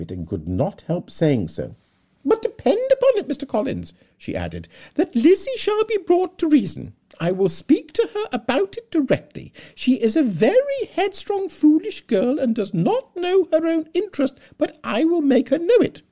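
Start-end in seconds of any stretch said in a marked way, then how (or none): 2.3-3.9 very high, loud, fluttering matron voice; fussy Mrs Bennet. Acted. 5.0-16.0 very high, loud, fluttering matron voice; fussy Mrs Bennet. Acted.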